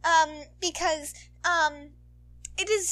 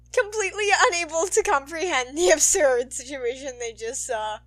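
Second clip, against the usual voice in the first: ordinary talk, nothing marked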